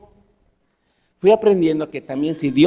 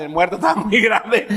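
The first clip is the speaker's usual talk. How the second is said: chuckling through the joke; laughing. Real, not acted. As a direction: laugh at your own joke about the suit. Joke about oversized borrowed suit. (riéndose de su propio chiste)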